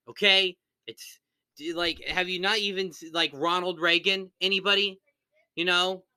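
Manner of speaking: high, exasperated telling-off; burst of temper. Real, not acted. Host calling out a YouTuber over a name, burst of temper. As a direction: scold them, hammering the correction. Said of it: "a quick burst of the V P's, uh, temper".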